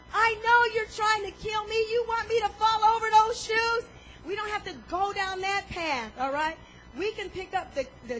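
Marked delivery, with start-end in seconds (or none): 0.1-3.8 mock paranoid accusation. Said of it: "get to the point where".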